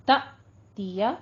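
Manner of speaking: "त दिया" with the voice caught after "त"; brief glottal stop. Shown as a demonstration. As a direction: stop the voice sharply on "त", then carry on into "दिया". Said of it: "हल्का सा आवाज को रोक के".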